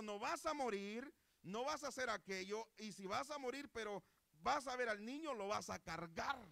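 loud, high-pitched sermon delivery; full-force preaching. Real, not acted. Preacher tells the congregation of Simeón, at full force. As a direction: proclaim it forcefully, phrase by phrase.

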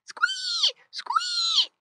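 very high piglet squee; repeated high-pitched squeal. A narrator's reading. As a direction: squeal like a pig held by the ear. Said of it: "cried Squinty".

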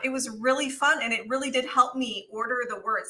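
ordinary delivery

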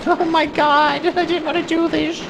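very high, shocked wail; horrified squeal. Real, not acted. Player horrified after shooting the monk, very high squeal. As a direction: squeal in dismay.

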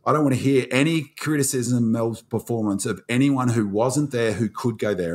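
normal talking voice

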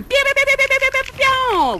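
mock storm effects. Imitating storm sounds.

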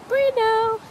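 high, loud call of the dog's name. Real, not acted. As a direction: shout his name to get him back. Calling the dog on walk.

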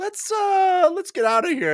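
hammed-up scared exit; nervous retreat. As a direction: squeak it out wanting to bolt. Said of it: "awesome interaction where he's like".

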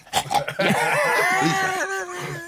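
very high imitated squeal; mock strangled squeal. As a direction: let out a drawn-out comic squeal. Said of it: "I'm there going".

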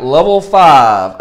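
slow, loud level call; slow announcement. Real, not acted. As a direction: draw it out like a host.

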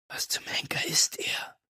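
soft breathy whisper in her ear. A narrator's reading. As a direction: whisper it so only she hears. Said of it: "flüsterte ich Julie ins Ohr".

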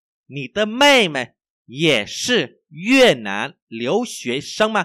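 very slow, loud, word by word; language teacher's model reading. Shown as a demonstration. Teacher reads for learners to repeat, slow model reading.

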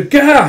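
high, loud "gah"; mock cry of alarm; acted startled yelp. Lord as an axe swings at him, acted yelp.

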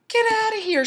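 put-on bossy girl shooing him off; high bossy schoolgirl. Dee Dee voiced in story.

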